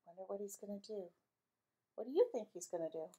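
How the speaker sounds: soft, quick conspiratorial aside; aside to listeners. Real, not acted. Storyteller asking listeners a soft, quick question.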